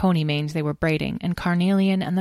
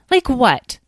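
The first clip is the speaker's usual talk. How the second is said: higher, falling challenge; demanding; 8 semitones higher, 7 dB louder than usual. A narrator's reading. Edwin challenging a busy sister, demanding. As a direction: demand an answer. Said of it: "demanded Edwin".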